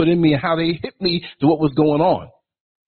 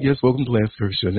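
lower, courteous show welcome; polite host voice; 8 semitones lower than usual. Retold or imitated. Host mimicking his polite welcome to viewers, courteous. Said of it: "I'm always polite".

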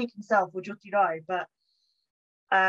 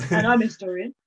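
normal talking voice

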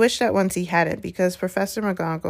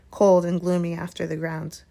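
ordinary delivery, nothing marked

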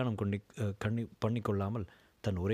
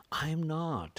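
firm, pressed I am not, a touch higher; said emphatically; 7 semitones higher, much slower than usual. Acted. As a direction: push back hard on not. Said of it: "அழுத்தமாக சொன்னான்".

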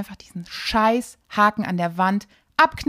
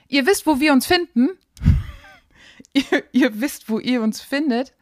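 laughing; 5 semitones higher, 6 dB louder than usual. Real, not acted. Laughing at own stumbling.

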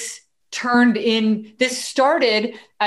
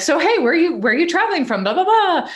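much higher, louder friendly chatter; breezy small talk that trails into blah blah; chirpy small talk; 9 semitones higher, 3 dB louder than usual. Retold or imitated. Striking up chat with a stranger in line, higher and louder.

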